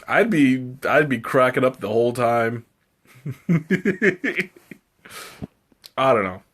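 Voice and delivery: normal talking voice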